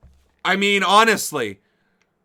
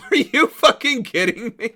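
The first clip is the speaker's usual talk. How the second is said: laughing disbelief; 6 semitones higher than usual. Real, not acted. Laughing disbelief at news report.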